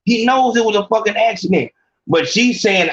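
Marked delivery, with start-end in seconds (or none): 0.1-1.7 mock excuses rattled off fast; hurried, half-hearted imitation of a cheating girlfriend; rushed excuses. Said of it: "if she said".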